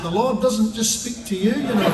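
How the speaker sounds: loud, slow, emphatic payoff; triumphant punchline. Retold or imitated. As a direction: hammer home the punchline slowly and loudly. Punchline shouted at the young missionary.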